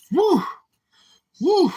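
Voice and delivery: high breathy woo. Real, not acted. High exhale after laughing.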